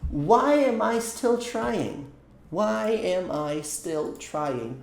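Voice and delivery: each word drawn out slowly for students; slow teaching demo. Shown as a demonstration. Teacher modelling a question for students, slowly. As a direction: spell it out slowly for a learner. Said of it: "Olha a nossa pergunta com why".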